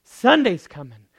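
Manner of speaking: triumphant call; loud Sunday's coming. Acted. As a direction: proclaim it. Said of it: "he says".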